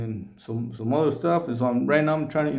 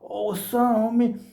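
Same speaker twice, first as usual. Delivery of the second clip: excited greeting; 8 semitones higher than usual. Retold or imitated.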